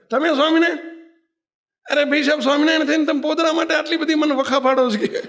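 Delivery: put-on high, amazed old woman with a laugh; astonished. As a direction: gasp it out in disbelief at who he is. Old woman recognises God. (ભગવાનને ઓળખી ચોંકી ગયેલી ડોશી, ઊંચા સૂરે હસતાં)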